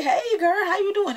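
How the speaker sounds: put-on high, bright hey girl; friendly greeting. Vlogger showing fans how to greet her in public. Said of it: "just say".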